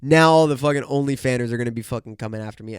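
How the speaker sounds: normal talking voice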